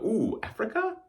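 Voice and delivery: high, hopeful ooh then a question; eager guess. Pretend. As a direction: blurt a confident wrong answer. Imagined viewer guessing.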